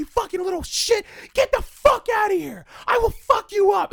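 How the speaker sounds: shrieking mock rage at teens; mock angry yell. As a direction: yell like an enraged moviegoer. Quoting angry guy in theater.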